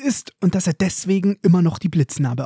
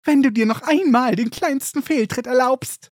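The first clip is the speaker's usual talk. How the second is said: high, mocking mother's voice; shrill mock mum; 7 semitones higher than usual. Malfoy mocks Crabbe's mother, high and shrill. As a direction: ape a fussing mother to taunt him. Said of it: "Mit einer sehr schrillen Stimme ruft er".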